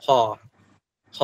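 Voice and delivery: ফ as a hard puffed p; aspirated p. Shown as a demonstration. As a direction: press the lips, then burst out ফ with breath. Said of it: "pressure দিয়ে তারপর চাপ দেবে".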